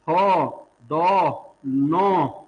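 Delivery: थो, दो, नो with the tongue tip on the teeth; teacher demonstrating थो and नो as dental sounds; dental sounds demo. Shown as a demonstration. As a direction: sound out थो दो नो one by one. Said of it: "कौन सा दंत व्यंजन नहीं है".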